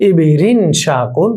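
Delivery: slow recitation. Shown as a demonstration. Teacher reciting chapter names.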